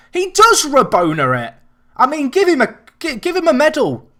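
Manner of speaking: loud, high cry of delight; excited shout. Real, not acted. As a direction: yell it out, thrilled by the trick. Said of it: "I've got a bit excited about that".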